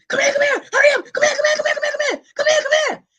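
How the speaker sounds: urgent high, loud rapid-fire summons, imitating grandma; grandmother calling. Grandmother calling from her room, imitated frantic yelling. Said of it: "She'd say, she's in her room".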